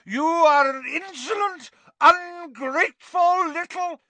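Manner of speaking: very high, loud, slowing fury; enraged aunt; raging Aunt Marge. A narrator's reading. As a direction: cut off mid-insult.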